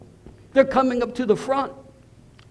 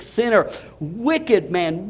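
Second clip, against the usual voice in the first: normal talking voice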